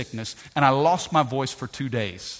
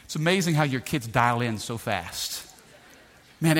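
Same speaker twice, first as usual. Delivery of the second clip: ordinary talk, nothing marked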